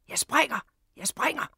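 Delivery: high, loud cry of a man on a ledge; desperate shout. Acted. Desperate man on a window ledge threatens to jump, shouting. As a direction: shout it out sharply, pitch leaping up. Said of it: "og manden skreg".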